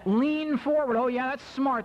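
high, mocking incredulity; sarcastic disbelief. Skier scoffing at girlfriend's advice, mock incredulous. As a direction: throw the advice back sarcastically.

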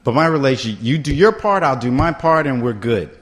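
ordinary talk, nothing marked

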